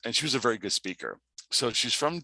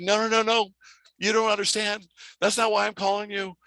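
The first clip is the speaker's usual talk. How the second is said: high-pitched insistent protest; 10 semitones higher than usual. Retold or imitated. Assistant correcting her boss on the phone, high and insistent. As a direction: correct them urgently, pitched well up.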